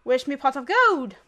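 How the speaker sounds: loud, hammed-up attempt at Irish; dubious, punchy Irish accent. Shown as a demonstration. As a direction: exclaim it like a cartoon Irish leprechaun. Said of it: "I could do Irish".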